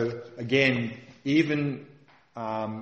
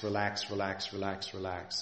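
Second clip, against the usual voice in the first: each relax softer and lower, fading away; softly fading relax; 3 semitones lower, 8 dB quieter than usual. Shown as a demonstration. Teacher easing meditators into letting go, fading softly. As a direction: let the repeated relax melt into quiet. Soothing repeated cue to relax.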